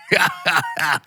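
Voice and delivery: sinister trader's slow cackle; merchant's laugh. Acted. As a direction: cackle like a shady game vendor. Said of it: "there's a merchant".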